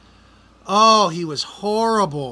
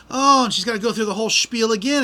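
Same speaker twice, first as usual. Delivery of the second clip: ordinary delivery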